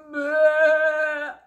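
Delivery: loud sheep bleat of disgust; a sheep's loud, disgusted bleat. Acted.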